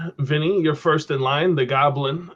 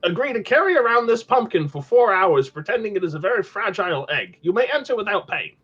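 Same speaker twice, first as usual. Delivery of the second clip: put-on much higher old goblin, speeding up at the end; 8 semitones higher than usual. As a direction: squeak out the deal, quickening. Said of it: "in an unnecessarily high, elevated voice".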